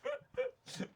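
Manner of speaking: high breathless squeak of a laugh; squeaky laugh. Real, not acted. Host cracks up at the vodka joke, squeaky laugh. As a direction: wheeze with laughter.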